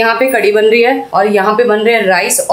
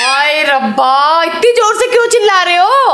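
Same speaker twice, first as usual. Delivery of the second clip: mock dismay; 4 semitones higher than usual. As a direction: lament the screaming in playful exasperation. Mock dismay at child's screaming.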